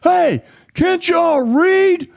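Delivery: high, slow scolding yell; scolding stranger. Retold or imitated. Stranger in the park scolding him over the dog, high, slow yell. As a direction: chew them out like an angry park-goer. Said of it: "he says".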